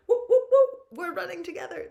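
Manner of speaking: high, loud whooping cheers; excited cheering. Retold or imitated. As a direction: hype up a passing runner. Cheering runners.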